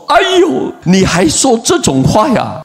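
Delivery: high-pitched wail of protest; retold disgusted exasperation. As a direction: act out a wife fed up with her husband. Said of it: "太太很反感". (模仿太太反感地惊叫)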